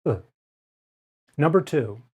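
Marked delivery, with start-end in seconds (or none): 0.0-0.2 short relaxed "uh"; schwa sound. Shown as a demonstration. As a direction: model the unstressed uh. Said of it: "shorter, unstressed sound".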